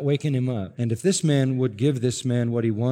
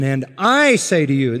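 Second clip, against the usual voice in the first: "I" punched, louder than the rest; stress on I; 7 dB louder than usual. Shown as a demonstration. Preacher stressing Jesus' "I" to the congregation, hitting it loud. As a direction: lean hard on "I" in "And I say to you". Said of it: "Ego is in the emphatic position".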